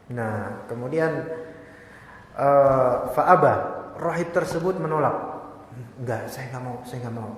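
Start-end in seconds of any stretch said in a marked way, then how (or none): 6.0-7.3 soft, low, steady no; quiet firm refusal. Acted. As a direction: refuse quietly without budging. Monk refuses the king.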